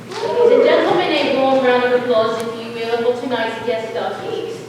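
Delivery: rally the crowd for applause; announcer call. Acted.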